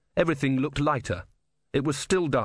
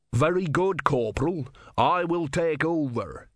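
higher, slow, deliberate command; commanding sergeant; 6 semitones higher, slower than usual. Acted. Sergeant relieving the corporal, slow commanding voice. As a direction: take charge with measured authority.

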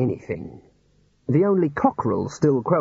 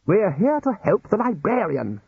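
snapping; 8 semitones higher than usual. Acted. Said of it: "snapped Ridcully".

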